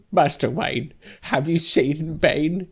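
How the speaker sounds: hammed-up tearful plea breaking into laughter; Michael Caine. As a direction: wail it like a terrified old butler. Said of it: "it's, like, him crying".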